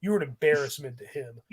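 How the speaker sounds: quiet cold put-down. Retold or imitated. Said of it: "And Peter's like".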